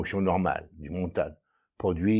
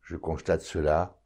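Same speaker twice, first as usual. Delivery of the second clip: calm, measured meditation voice, lower and softer; guided meditation; 3 semitones lower, 4 dB quieter than usual. Acted. Meditation guide leading listeners to notice their breathing, calm and unhurried. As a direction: guide the listener inward, with long silences between phrases.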